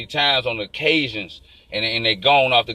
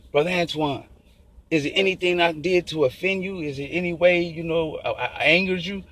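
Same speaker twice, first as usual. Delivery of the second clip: lightly voiced pastor, soft and a bit higher; a hint of a worried pastor asking gently; imitating the pastor; 4 semitones higher, 3 dB quieter than usual. Pastor asking Brother Antoine if he offended him, mildly imitated softer voice.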